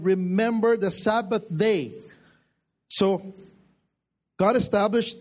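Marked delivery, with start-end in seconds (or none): 0.0-1.9 remember stressed, phrase said slowly; emphasis on remember. Shown as a demonstration. Pastor stressing the scripture to the congregation, emphatic. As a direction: lean on remember, then let Sabbath day fall. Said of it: "emphasize the word".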